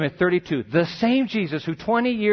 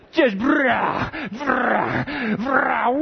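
mock angry sputter; 6 semitones higher, much slower than usual. Parent's fury at late child.

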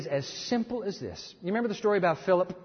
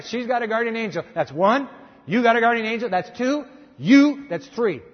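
emphatic counting; 4 semitones higher, 7 dB louder than usual. Real, not acted.

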